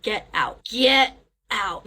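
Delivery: drawn-out, forceful get out; retold shout. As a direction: shout it slowly, stretching each word. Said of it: "it shouts, like".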